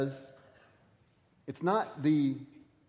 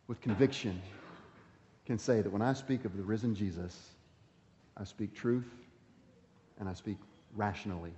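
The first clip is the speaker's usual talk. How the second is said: softer, calm and sure; quiet conviction; 6 semitones lower, 9 dB quieter than usual. Real, not acted. Preacher to congregation, quiet and peaceful conviction.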